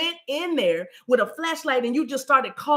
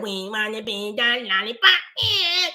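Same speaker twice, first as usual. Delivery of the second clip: angry munchkin. Acted. Rage coming up like a munchkin, cranky munchkin voice. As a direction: imitate a cranky little munchkin. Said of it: "it's like a munchkin".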